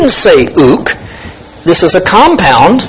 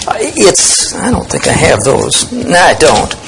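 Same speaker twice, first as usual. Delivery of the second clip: ordinary talk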